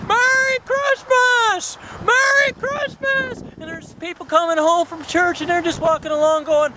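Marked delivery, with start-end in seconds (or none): 0.1-3.4 high, drawn-out joyful holler; crazy-man shouting. Acted. George running down the street like a crazy man, joyful shouting. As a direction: shout it slowly to the whole street. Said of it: "running down the street like a crazy man going".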